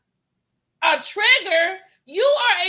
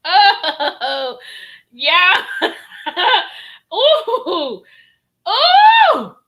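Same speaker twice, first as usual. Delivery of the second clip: loud laughing whoops rising higher; loud laughter; 3 semitones higher, 9 dB louder, much slower than usual. Real, not acted. Reactor howls with laughter at the guest's lie, loud.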